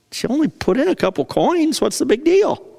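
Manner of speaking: high, unimpressed shrug; so what, it's just coins; dismissive disciple. Acted.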